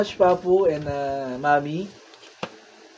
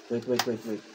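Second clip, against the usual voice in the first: hushed; 8 semitones lower, 10 dB quieter, faster than usual. Real, not acted. Hushed while handling the box.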